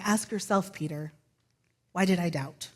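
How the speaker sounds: soft inner question. Acted. Jesus' imagined inner question.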